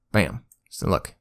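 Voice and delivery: usual speaking voice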